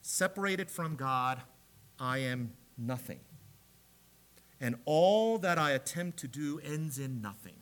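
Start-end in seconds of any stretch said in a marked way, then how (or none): 0.0-3.2 solemn hush. Real, not acted. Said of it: "As John Calvin states".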